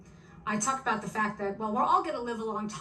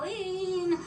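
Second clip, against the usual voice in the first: Queens accent, a mock yell of Arlene; mother's Queen's accent yell; 7 semitones higher than usual. Mother calling her daughter, mock Queens yell. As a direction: call the name out in a New York Queens accent, pitched up. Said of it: "she would yell in her Queen's accent".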